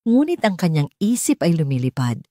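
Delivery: plain reading voice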